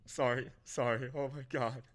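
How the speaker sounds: high, breaking tearful voice; choked up and crying happily; crying with joy. Real, not acted. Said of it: "There are tears down my eyes right now".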